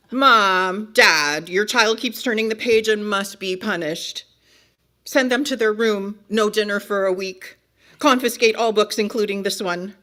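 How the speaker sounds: loud tattling shout for the parents; holler, then rattle off the punishments; calling out indignantly. Acted. Book character calling parents to punish the reader, indignant.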